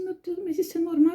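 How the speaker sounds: bem mais aguda, assustada; pergunta aflita. Retold or imitated. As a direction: pergunte com medo crescente. Mãe pergunta assustada no parto. (mãe aflita pergunta ao anestesista, assustada)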